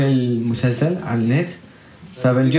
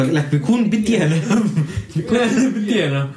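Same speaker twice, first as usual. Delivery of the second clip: higher, laughing through the words; real laughter bubbling into a raised voice; 5 semitones higher than usual. Real, not acted. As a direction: break into a laugh while admitting it. Laughs while admitting wanting sleep.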